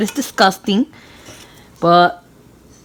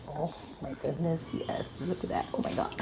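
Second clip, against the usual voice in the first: very soft, half-whispered amazement; hushed awe; 14 dB quieter than usual. Real, not acted. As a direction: drop almost to a whisper, awestruck by the food. Awed at tasting the food.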